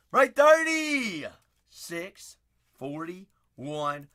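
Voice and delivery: drawn-out announcer call; hype announcement. Real, not acted. Announcing the break.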